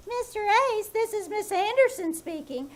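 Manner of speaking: mock-prim imitation of the secretary. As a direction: parrot her formal announcement. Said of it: "You don't have to say".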